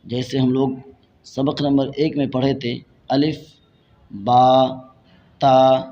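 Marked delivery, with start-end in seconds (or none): 3.1-5.8 each letter name set apart by a pause; letters read separately. Shown as a demonstration. Teacher showing how lesson one was read, separated letters. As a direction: sound out the letters one at a time, firmly. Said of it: "الگ الگ کر کے".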